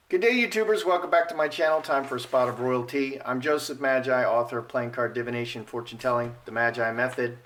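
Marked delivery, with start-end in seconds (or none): none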